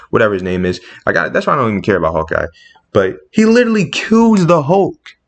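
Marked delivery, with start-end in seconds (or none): 3.3-5.2 high, indignant insistence; appalled emphasis. Real, not acted. Fan appalled that Hawkeye kills Hulk, indignant. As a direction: press the point in high-pitched disbelief.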